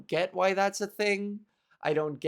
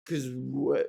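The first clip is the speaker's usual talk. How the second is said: mock Toronto bro; 3 semitones lower than usual. Mocking a Torontonian proud of his shirt, smug voice. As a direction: sneer it like a smug Toronto fan. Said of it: "Those pretentious fucking shirts".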